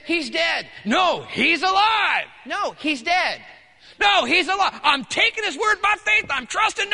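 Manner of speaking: high, shouted back-and-forth argument; loud, frantic-sounding acted refusal to listen; heated denial. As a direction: shout down the bad news insistently. Acted quarrel with servants over death.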